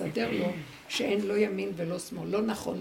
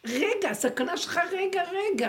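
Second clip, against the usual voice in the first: alarmed cry; 10 semitones higher, 5 dB louder, slower than usual. Acted. Alarm at the snake.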